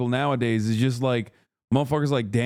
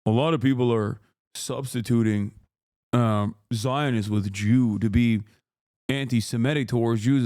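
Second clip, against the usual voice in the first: mock Jake Tapper; 4 semitones lower than usual. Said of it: "He's like".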